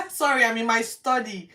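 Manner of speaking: apologising to the live audience, laughing; laughing apology. Real, not acted. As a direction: laugh it off to the viewers. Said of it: "laughs sorry".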